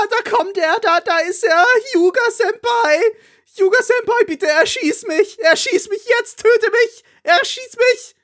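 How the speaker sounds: very high, swooning fangirl voice; swooning anime fangirl. Pretend. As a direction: squeal like a lovesick schoolgirl. Fangirl begging senpai to shoot. (hohe, schmachtende Anime-Fangirl-Stimme)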